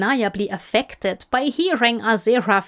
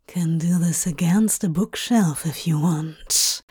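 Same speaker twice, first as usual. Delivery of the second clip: low whispered growl in the ear; 4 semitones lower than usual. A narrator's reading. Crowley growling into his lover's ear. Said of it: "Crowley growled in his ear".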